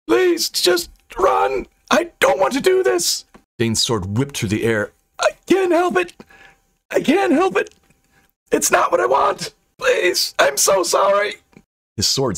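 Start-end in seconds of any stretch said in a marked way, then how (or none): 0.1-3.3 very high, anguished plea; helpless high-pitched cry; desperate begging. A narrator's reading. Possessed man begging friends to flee. 5.2-11.4 very high, anguished apology; frantic high-pitched cry; desperate begging. A narrator's reading. Possessed man begging, cannot stop himself.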